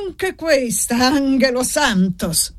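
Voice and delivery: high, slow exasperated exclamation; mock-scandalised priest; exasperated priest's cry. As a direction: throw up your hands in outrage. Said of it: "gridò il prete battendo le mani".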